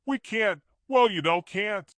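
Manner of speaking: halting, stumbling start-stop; stuttering. A narrator's reading. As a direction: Shane stammering to Ashley, stuttering and halting.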